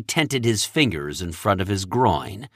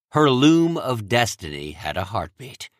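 plain reading voice

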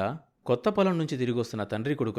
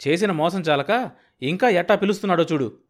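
raised, louder indignant outburst; angry grumble; 6 semitones higher, 7 dB louder than usual. A narrator's reading. Sambayya fuming as the man who cheated him calls out, indignant. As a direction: fume at the cheek of the man. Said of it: "కోపాన్ని కూడా కలిగించింది".

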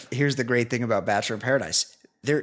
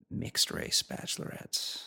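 mock-secretive whisper; hushed; 11 dB quieter, slower than usual. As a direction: drop to a slow, confidential whisper. Naughty aside, hushed reveal.

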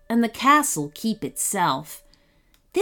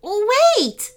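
high, loud urgent cry; shout; 12 semitones higher, 5 dB louder than usual. A narrator's reading. Prince Joshua interrupts the dragon, shouting. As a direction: shout to halt them, pitched high. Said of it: "shouted Prince Joshua".

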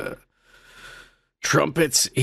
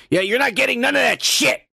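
brushing off shout; 7 semitones higher than usual. Real, not acted. Podcast host shouting down a viewer's request for more content, blunt refusal. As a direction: shout the refusal like it's obvious.

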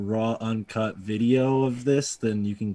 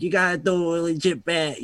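a light New England accent imitating a friend; 7 semitones higher than usual. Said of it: "with his New England accent".

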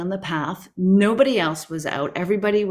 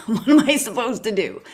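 laughing; 4 semitones higher than usual. Retold or imitated. Laughing at her own question.

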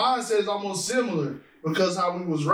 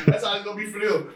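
normal talking voice

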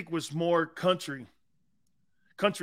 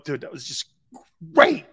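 awed praise; 4 dB louder than usual. Retold or imitated. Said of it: "I'd go".

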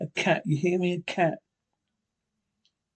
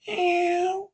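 much higher, slow drawn-out meow; cat meow; 13 semitones higher than usual. Acted. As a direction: mew like a cat. Linda the cat.

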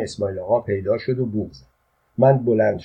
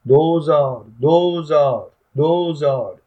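raised hawker's cry, each word falling; louder street vendor's shout; shouting prices; 4 semitones higher, 3 dB louder, slower than usual. A narrator's reading. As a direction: call out the price to the crowd.

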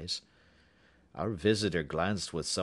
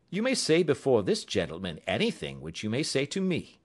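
a lightly voiced, higher, crisp detective; Holmes, cool and courteous, raised in pitch; 7 semitones higher, 5 dB louder than usual. A narrator's reading. Holmes introducing Watson to the visitor, politely.

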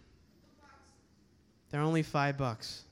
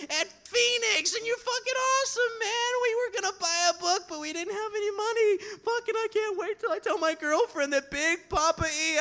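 starstruck fan squeal; 13 semitones higher, 3 dB louder than usual. Retold or imitated. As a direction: squeal like you met your idol.

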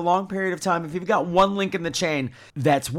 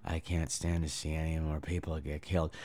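a hint of a flat, low, quiet monotone; lightly imitated wooden movie hero; wooden actor voice; 12 dB quieter than usual. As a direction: drone the lines like a bored actor. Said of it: "you delivered all of his lines right now".

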